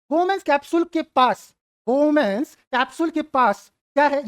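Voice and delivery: very slow, each word of Bowman's capsule drawn out; slow drilling. Shown as a demonstration. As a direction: spell it out slowly for the class. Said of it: "teacher drills the term". (बहुत धीरे, हर शब्द खींचकर)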